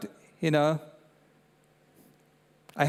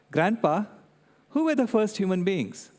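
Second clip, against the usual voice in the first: lightly voiced little girl, a touch higher; granddaughter's question; 5 semitones higher than usual. Granddaughter at bedtime asking grandpa, in a lightly voiced child tone. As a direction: ask it like a curious five-year-old, lightly imitated. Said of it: "she said".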